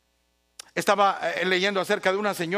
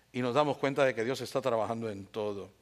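usual speaking voice

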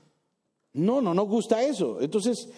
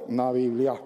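put-on disappointed boy; 4 semitones lower than usual. Boy announces the gift flatly.

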